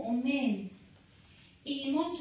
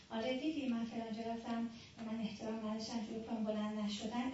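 gossiping woman; 5 semitones lower, 7 dB quieter than usual. Retold or imitated. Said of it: "شروع کرد غیبت کردن".

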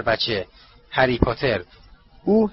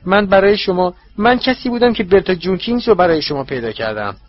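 higher, louder, wounded protest; hurt servant; 6 semitones higher, 4 dB louder than usual. Acted. Wormtail hurt, reminding his master of his service. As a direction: sound stung and injured.

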